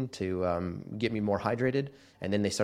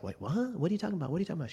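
stunned disbelief; 9 semitones higher, 5 dB quieter, much faster than usual. Retold or imitated. Sister says go to hospital.

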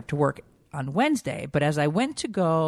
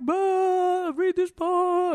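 high, wailing imitation of her own tears; retelling how she cried to her husband, high mock wail; mock crying; much slower than usual. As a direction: replay the sob through the words, hammed-up. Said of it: "And I just started crying".

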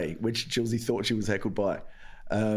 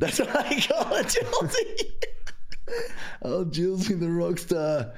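laughing through the words, high; cracking up mid-line; 7 semitones higher, slower than usual. Real, not acted. Host cracking up at the comic's nickname, laughing.

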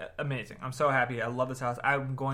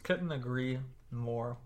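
ordinary talk

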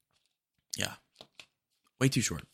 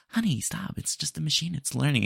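put-on calming girlfriend; 7 semitones higher than usual. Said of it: "she's trying to calm you down".

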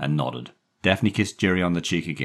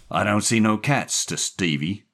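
gruff taunt, louder at the start; mocking man; 6 semitones higher, 5 dB louder than usual. A narrator's reading. Jerry finding the trunk empty, mocking. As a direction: copy his stammer as a jibe. Man mocks boy's stammer.

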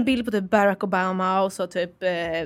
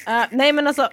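usual speaking voice

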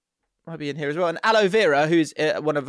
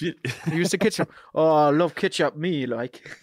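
badly faked Sunderland accent. Host struggling with a requested accent, rough Sunderland. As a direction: have a shaky go at Sunderland. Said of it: "only accent I could do".

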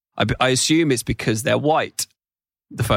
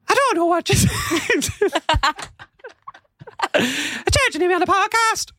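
much higher, comic Irish accent; attempted Irish accent. Shown as a demonstration. Co-host trying an accent on request, high-pitched Irish.

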